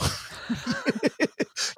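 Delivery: high, soft burst of laughter; breathy giggles at one's own retold joke; laughing. Real, not acted. Retelling a funny tour guide sketch, real laughter.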